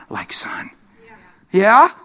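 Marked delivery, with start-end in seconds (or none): 0.1-0.7 breathy whisper to close the line; whispered punchline. Real, not acted. Preacher ending the father-son line in a whisper. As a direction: drop to a whisper for effect.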